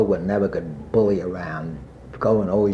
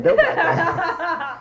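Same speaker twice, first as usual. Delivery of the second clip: laughing; 3 dB louder, much slower than usual. Retold or imitated. Laughing while quoting himself.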